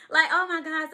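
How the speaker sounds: laughing through the words. Real, not acted. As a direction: giggle it out in disbelief. Laughing in happy disbelief.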